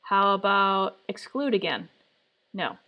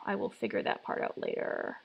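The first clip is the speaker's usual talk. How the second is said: whispered aside; 10 dB quieter than usual. Real, not acted. Repeats the line under her breath.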